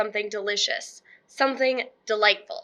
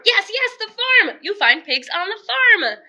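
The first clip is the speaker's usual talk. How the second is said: much higher and louder, gleeful; thrilled; 9 semitones higher, 5 dB louder than usual. Acted. Witch realizes the farm has pigs, gleefully shrieking. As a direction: squeal with triumph.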